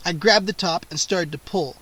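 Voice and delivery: plain reading voice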